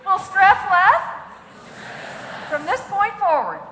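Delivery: loud, rallying call to repeat; leading a vow. Shown as a demonstration. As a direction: lead the crowd through the oath. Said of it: "Raise a hand and repeat after me".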